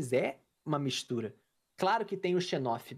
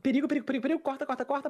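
neighbor's urgent warning; 8 semitones higher, faster than usual. Retold or imitated. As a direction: warn him frantically. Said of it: "bateu no meu ouvido e falou". (vizinho avisando no ouvido, agudo e urgente)

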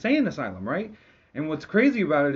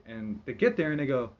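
normal talking voice

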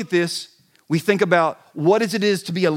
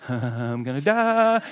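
mock terror; 8 semitones lower, 4 dB quieter, slower than usual. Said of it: "I'm just, like, going".

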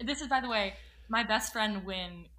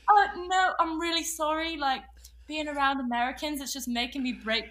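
put-on high, loud faltering British accent; hammed-up British, louder and higher; 5 semitones higher, 12 dB louder than usual. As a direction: excuse yourself in a pretend British accent. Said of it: "I'm, like, losing my accent".

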